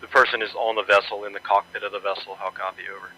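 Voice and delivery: distant tinny radio voice; crackly military radio. Real, not acted. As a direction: talk through a crackling field radio. Radio reply from sector.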